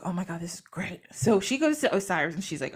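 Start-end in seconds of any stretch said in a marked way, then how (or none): 0.0-1.0 hushed, whispered amazement; awed whisper. Retold or imitated. Isis feeling all her new powers, awed whisper. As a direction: whisper it to yourself in wonder.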